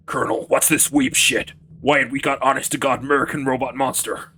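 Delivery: put-on loud southern US accent. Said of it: "whenever I try to do the southern voice".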